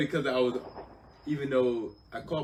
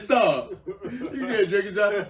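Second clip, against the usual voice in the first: mock scolding; 11 semitones higher, 11 dB louder, slower than usual. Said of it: "I was like".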